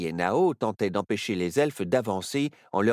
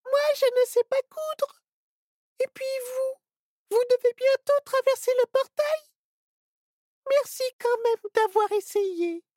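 put-on sad little girl. A narrator's reading. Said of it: "avec tristesse".

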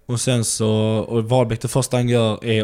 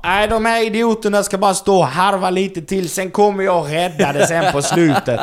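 high-pitched, mock-pompous boasting; cocky, strutting proud; 7 semitones higher, 3 dB louder than usual. Star comic struts in and addresses the room, mock cocky. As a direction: crow it like a proud rooster taking over. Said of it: "stolt som en jävla tupp".

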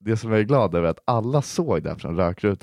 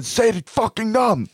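high barked demand, shifted voice; mock bouncer shout; 12 semitones higher, slower than usual. Bouncer yelling at drunk Linton for his name, high shout. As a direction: yell it like a pushy bouncer.

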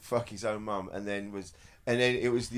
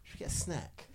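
twee voice; 11 dB quieter than usual. Retold or imitated. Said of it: "the way it says".

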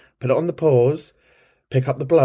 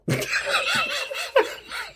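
airy, high giggle; high breathy laugh; 6 dB quieter than usual. Real, not acted. Host laughing at her own French joke, high giggle.

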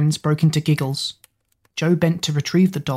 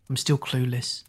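low, soft resignation; quietly admitting defeat; sighing; 3 semitones lower, 7 dB quieter than usual. A narrator's reading. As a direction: let it drop into a sigh. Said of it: "he sighed".

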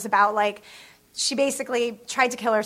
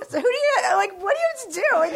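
exasperated rant; 9 semitones higher than usual. Retold or imitated. Retold exasperation continues.

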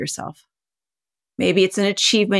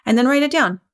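ordinary talk, nothing marked

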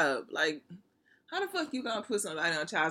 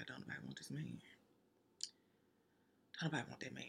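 whispered aside; 4 semitones lower, 17 dB quieter, much faster than usual. Real, not acted. Aside to listeners.

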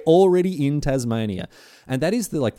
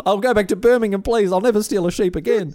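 higher, louder begging; mock pleading convict; 10 semitones higher, 4 dB louder than usual. Convict begging the judge to send him home, hammed-up plea. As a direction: plead like a thief before the judge. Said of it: "pleading convict".

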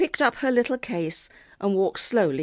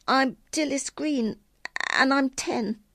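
put-on high child's voice; little girl; 8 semitones higher than usual. A narrator's reading. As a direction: introduce yourself as a timid child, pitched high. Little girl introduces herself in story.

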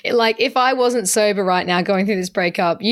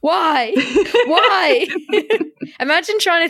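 laughing; 8 semitones higher, slower than usual. Real, not acted. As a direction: repeat it, laughing through it. Laughing in disbelief at the submission.

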